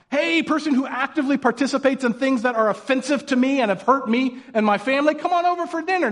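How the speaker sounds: high, mock-cheery invite; sarcastic invitation. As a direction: sing it out like a fake welcome.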